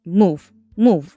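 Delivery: loud, sharp order to move; fed-up bark; loud command. Acted. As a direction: shove her aside with your voice. Scolding Poppy, ordering aside.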